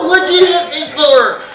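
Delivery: high, loud mock wail; protesting kid. Kid protests to dad after being hit, wailing. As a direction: complain like a smacked child.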